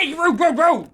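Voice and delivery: loud, high protesting cries; indignant yelling. Real, not acted. Streamer cut off while praising viewers, loud high protest. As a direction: yell each word in mock outrage. Interrupted mid compliment by disruption.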